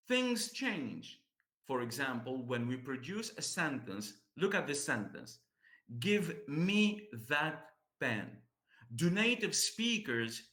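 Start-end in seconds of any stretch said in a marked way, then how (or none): 6.0-8.4 slow, each word separate and fully pronounced; careful unlinked citation-style delivery; robotic word-by-word. Shown as a demonstration. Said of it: "speak in this way".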